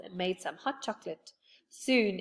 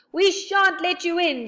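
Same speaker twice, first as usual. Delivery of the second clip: high, loud defiant yell; 10 semitones higher, 7 dB louder than usual. A narrator's reading. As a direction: defy the bears outside. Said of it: "yelled Joe".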